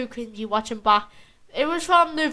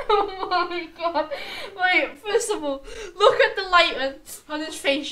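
laughing, higher, words through giggles; can barely get words out for laughing; 5 semitones higher than usual. Real, not acted. Laughing at friend's face.

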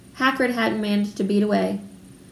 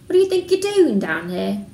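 witch voice; 4 dB louder than usual. A narrator's reading. Witch character voiced in story.

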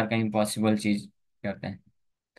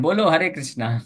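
retold shout; 5 semitones higher than usual. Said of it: "यहाँ से चिल्लाते हैं".

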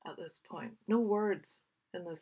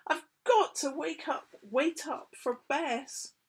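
higher, louder pleading; insistent child; 8 semitones higher, 6 dB louder than usual. Acted. Sam insists on waiting for Bess. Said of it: "cried Sam".

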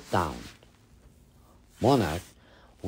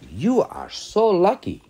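higher, louder cry of longing; envious exclaim; 11 semitones higher, 5 dB louder than usual. Acted. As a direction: gush with envy at your friend.

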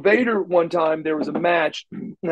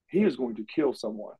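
quiet muttered worry; 5 semitones lower, 9 dB quieter than usual. Retold or imitated. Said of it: "I'm like".

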